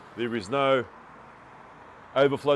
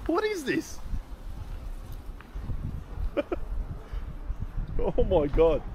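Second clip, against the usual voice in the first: much higher, baffled; real astonishment at a strange bird; surprised; 12 semitones higher than usual. Real, not acted. Baffled, much higher, asking viewers about a strange bird.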